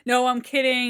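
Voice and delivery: ordinary talk, nothing marked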